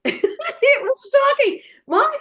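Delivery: high, drawn-out words through laughter; laughing. Real, not acted. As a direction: laugh as you say it. Laughing at her own story.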